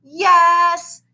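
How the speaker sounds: high chorus "Yes"; the whole class answering as one; class chimes. Acted. Class chimes an answer to teacher, high. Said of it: "chimed the class".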